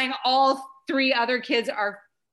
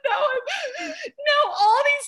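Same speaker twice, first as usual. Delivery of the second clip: very high, loud, tearful shrieking rant; hammed-up screaming; 7 dB louder than usual. Mom having a meltdown at the secretary, screaming and bawling. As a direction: wail it like a hysterical meltdown, played for laughs. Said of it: "I'm screaming back at her".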